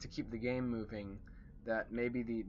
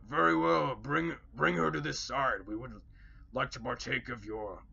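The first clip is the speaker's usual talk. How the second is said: a hungry orc eager for the feast; 3 semitones higher, 5 dB louder than usual. Acted. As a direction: orc leader accepting the offer, loud and hungry. Orc leader replies.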